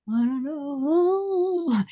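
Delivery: put-on very slow, drawn-out kids' reply; stumped children. Schoolkids answering the rabbi's riddle, very slow and drawn out. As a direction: drag it out like puzzled schoolkids.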